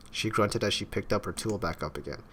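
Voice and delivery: normal talking voice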